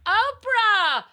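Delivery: high, loud scream of a name; piercing shouted name; screaming Oprah. Shown as a demonstration. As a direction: yell it out like you're being abducted. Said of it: "just start screaming Oprah".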